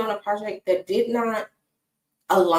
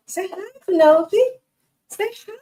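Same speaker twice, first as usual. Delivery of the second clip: pet talk; 11 semitones higher, 5 dB louder than usual. Real, not acted. Owner prompting her dog to greet the camera, high baby talk. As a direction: coax the puppy into saying hi.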